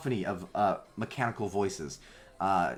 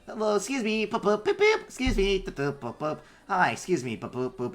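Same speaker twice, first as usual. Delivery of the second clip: high chirpy little robot; 10 semitones higher, 4 dB louder than usual. Acted. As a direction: squeak like a busy little bot. Little runner bots chirping.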